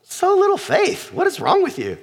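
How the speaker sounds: high-pitched exasperated scolding, dropping at the end; exasperated Jesus rebuke. Acted. Jesus woken by frightened disciples, exasperated rebuke. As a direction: scold the panicked followers in disbelief. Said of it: "And he's like".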